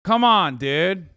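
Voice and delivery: loud, high pleading come on; exasperated protest. Real, not acted. Streamer pleading with chat not to shave, loud. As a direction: beg them to drop it. Friends pushing him to shave head.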